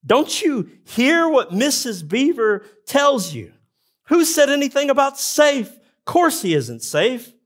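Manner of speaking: high, loud, worked-up outburst; an excited beaver who can't believe the question; excited Mister Beaver. Acted. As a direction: burst out indignant and thrilled at the question. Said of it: "Mister Beaver gets really excited".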